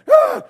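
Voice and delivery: mock rage yell; Hulk roar. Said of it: "the Hulk dad".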